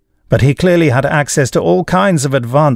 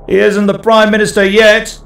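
called out; 10 semitones higher than usual. Acted. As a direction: call it out from the press pack. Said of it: "called out a journalist".

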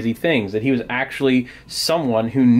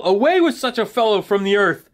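high-pitched storybook crowd yell; mock angry yell; 8 semitones higher than usual. Angry crowd demanding Paul be taken away, mock yell. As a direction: cry out high, without full volume. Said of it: "they started to yell".

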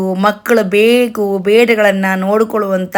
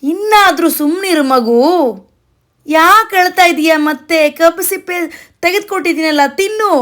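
high-pitched, lightly acted fatherly coaxing; lightly voiced dad hushing a child; 7 semitones higher than usual. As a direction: plead with a fussy child. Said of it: "ತಂದೆ ಥರ ನಟಿಸ್ತಾ ಇದ್ದಂಥ ಅಕ್ಬರ".

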